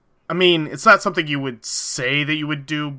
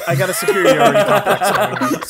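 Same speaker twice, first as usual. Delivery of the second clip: laughing; 4 semitones higher, 6 dB louder than usual. Real, not acted. Laughing joke.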